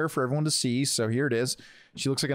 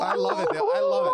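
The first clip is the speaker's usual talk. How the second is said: delighted; faster than usual. Real, not acted. Cohost delighted by the impression.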